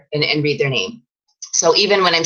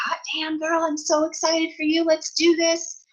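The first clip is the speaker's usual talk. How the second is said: hyped-up coach; 12 semitones higher, 6 dB quieter than usual. Retold or imitated. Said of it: "she was like this".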